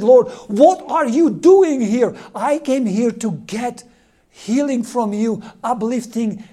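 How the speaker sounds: loud, pleading outcry, drawn out; crying out to God. Retold or imitated. A priest crying out to God for healing, loud and anguished. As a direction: cry it out to the Lord in anguish. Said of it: "And he cried out".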